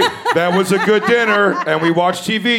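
high, loud playing house voice; playful kid voice. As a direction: play pretend grown-up like a kid. Acting kids playing house.

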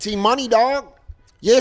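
high-pitched street-slang heckler impression; hammed-up nineties wannabe. Offended crowd member to comic, hammed-up slangy wannabe voice. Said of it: "That's why I talk like this".

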